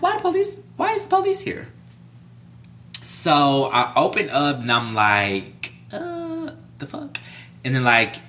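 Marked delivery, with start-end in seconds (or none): 0.0-1.7 very high, squeaky bewilderment; retold alarmed disbelief. Retold alarm at police outside. 5.9-7.2 high, soft, baffled reaction; retold bewilderment.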